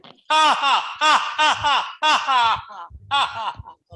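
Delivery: high loud laugh from the belly; big hearty laugh. Shown as a demonstration. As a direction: let it roll up from the belly. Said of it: "from the bottom of my belly".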